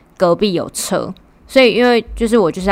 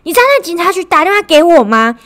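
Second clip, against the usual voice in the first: imitating a demanding mom, higher-pitched; mom interrogating; 9 semitones higher, 6 dB louder than usual. Mom calls to grill her kid about the day, in a demanding voice. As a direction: grill your kid over the phone. Mom reports police call.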